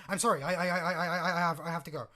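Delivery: captain fleeing her lover in fright, flustered; panicked apology. Acted. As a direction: rush the words out in a fluster. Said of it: "like she's seen a ghost".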